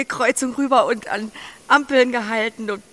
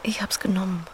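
tender whispered confession; 4 semitones lower, 4 dB quieter than usual. Acted. Emma confides her loneliness to Max, whispering. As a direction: breathe it close to his ear.

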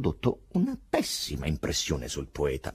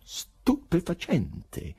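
very slow, higher, stretched-out amazement; amazed exclamation; 11 semitones higher, much slower than usual. A narrator's reading. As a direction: draw out the astonishment. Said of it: "esclamò l'inatteso interlocutore".

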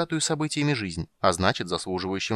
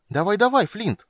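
high, loud impatient urging; bursting with impatience; 12 semitones higher, 5 dB louder than usual. A narrator's reading. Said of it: "умирал от нетерпения Тасс".

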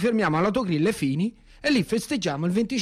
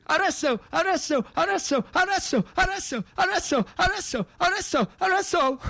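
higher, hammering each word; rising insistence; 8 semitones higher than usual. Acted. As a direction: pound the word over and over. Escalating repeated word. (insistente, sempre più acuto)

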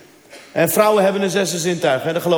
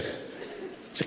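ordinary talk, nothing marked

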